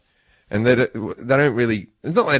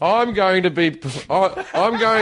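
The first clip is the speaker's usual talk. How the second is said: higher, gasping mum voice through a laugh; mock breathless mum; 8 semitones higher, slower than usual. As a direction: pant the words out like a winded mum. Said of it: "your mom's out of breath".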